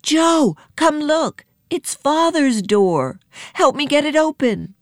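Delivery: high, pleading call; acted excited appeal for help; urgent cry. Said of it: "she cried".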